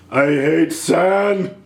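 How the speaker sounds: slow, drawn-out brooding line; mock Anakin. Vlogger parodies Anakin's moody sand line. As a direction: sulk it out slowly.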